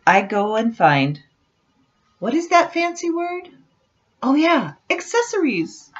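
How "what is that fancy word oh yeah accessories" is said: higher, pondering then triumphant; playful searching. Acted. Nancy remembering the fancy word, higher playful voice. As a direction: pretend to hunt for the word.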